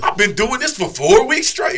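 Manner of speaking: high, loud protest; hammed-up indignant yelling; indignant boast. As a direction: protest your tiny career at full volume. Retold outrage, mock self-importance.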